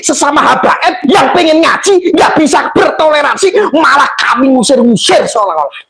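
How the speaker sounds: real, high-pitched impassioned protest; indignant outcry. Real, not acted. Indignant protest over intolerance among Muslims. (seruan kecewa, berapi-api)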